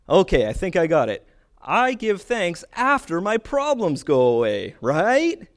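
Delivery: hammed-up false realisation, loud and high; confused questioner. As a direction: think you've cracked it, ending on a rising check. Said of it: "I'm confused".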